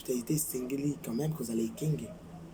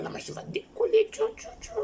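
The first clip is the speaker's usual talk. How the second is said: high, airy choo-choo imitation, mock take-off; playful train noise; 5 dB louder than usual. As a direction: puff it out like a toy train leaving.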